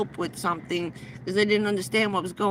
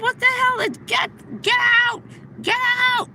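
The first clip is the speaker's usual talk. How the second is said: screaming; 13 semitones higher than usual. Retold or imitated. Hacker screaming at them to leave, frantic yell. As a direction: throw them out at full voice. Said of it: "when he got done yelling at us".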